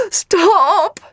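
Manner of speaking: high, loud panicked squeak. Acted. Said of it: "he squeaked".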